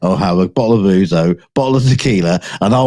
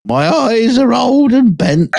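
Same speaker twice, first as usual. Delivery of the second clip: put-on old man voice; 12 semitones higher, slower than usual. Jokey old man line.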